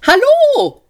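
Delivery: very high, loud, gushing hello; sweetly amiable. A narrator's reading. Talking lift greeting its passengers, sweetly amiable. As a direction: greet them with over-sweet friendliness. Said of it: "sagte der Fahrstuhl liebenswürdig".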